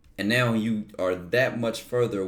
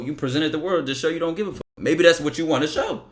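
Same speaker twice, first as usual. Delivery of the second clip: usual speaking voice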